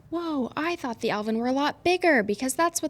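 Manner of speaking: high-pitched imitation of astonished pupils; amazed kids. Teacher relaying pupils' reaction at the hatchery, amazed. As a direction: act out kids' wide-eyed surprise, pitch lifted. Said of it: "they were like".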